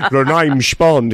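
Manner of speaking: a lightly done Connery, drawn out; Sean Connery impression. Party guest doing his Sean Connery, slow. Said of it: "it's Sean Connery".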